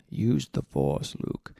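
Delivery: low, slow, solemn Jedi voice; a bad imitation of a wise old Jedi, slow and low; Obi-Wan Kenobi impression. Quoting Obi-Wan to listeners, low slow Jedi voice. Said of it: "in the voice of Obi-Wan Kenobi".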